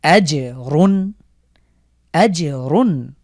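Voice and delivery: আজ রুন very slow, জ bounced; slow, echo the জ; qalqalah on জ. Shown as a demonstration. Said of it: "জিমের উপরে কিন্তু কলকলা করছি".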